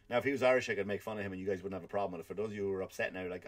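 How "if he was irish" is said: normal talking voice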